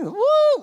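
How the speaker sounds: high, loud whoop; high woo. Real, not acted. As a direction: let out a squeaky woo. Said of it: "I sound a bit funny".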